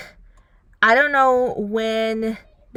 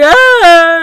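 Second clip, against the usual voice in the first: much higher and louder imitated tantrum scream; mock scream; 8 semitones higher, 14 dB louder than usual. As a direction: ham up a furious yell.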